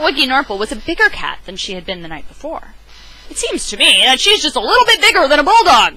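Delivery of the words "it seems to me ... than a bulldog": louder, raised, insisting; firm louder insistence; insistent. Acted. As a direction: press the point a bit louder. Louie insists cat grew.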